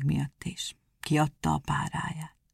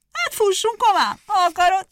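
eager hare; 13 semitones higher, 7 dB louder than usual. Acted.

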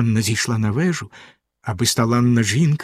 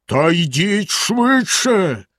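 slow, higher, drawn-out roar; Bluebeard bellowing; 6 semitones higher, much slower than usual. A narrator's reading. Bluebeard bellows again at his wife, slow and menacing. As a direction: roar the order up the stairs. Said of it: "знову заволав синя борода".

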